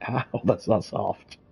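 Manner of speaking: laughing protest at a bite; laughing ouch. Real, not acted. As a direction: laugh it out in pain. Cat bites hard.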